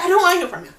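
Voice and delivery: loud, high shout of refusal; furious refusal. Retold or imitated. Cutting off her friend D, shouting high and loud. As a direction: shut him down hard.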